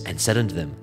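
usual speaking voice

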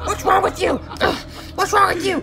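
high-pitched bewildered cry; exasperated dismay. Acted. Ferb baffled by laughing opponent.

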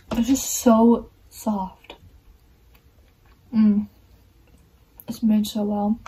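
talking with gum in mouth; chewing between words; chewing gum while talking. Real, not acted. Seen 2: Gum put in mouth at -3; jaw and lips chew between words.

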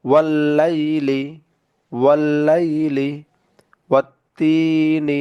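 very slow "والليل", double l held and vowels drawn out; tashdid pronunciation demo. Shown as a demonstration. Teacher modelling Arabic for students, slow drawn-out "والليل" with held l. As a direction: stretch "والتين" out slowly, leaning on the doubled t. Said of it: "উচ্চারণগুলো পড়ে দিচ্ছি".

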